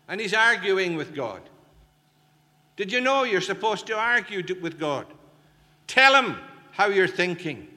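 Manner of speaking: high, loud urging; rousing preaching. Acted. Preacher urging listeners to argue with God, loud and high. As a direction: press them hard to argue with God.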